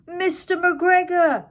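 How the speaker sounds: frightened gasp. A narrator's reading. Peter spotting the farmer, gasping in fright. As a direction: blurt the name in sudden fright. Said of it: "Peter gasped".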